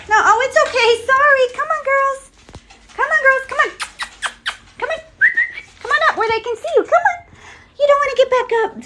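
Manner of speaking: high, fast coaxing to dogs; high sing-song dog talk; calling the dogs. Real, not acted. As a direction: coax the dogs up, high and eager. Calling dogs onto the bed.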